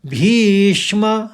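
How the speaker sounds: "भीष्म" chanted high, its श sound given lightly twice; slow recitation. Shown as a demonstration. Teacher showing how to say "भीष्म". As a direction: hold "भीष्म" long and light. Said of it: "शौ दो बार हल्के से निकाल दीजिए".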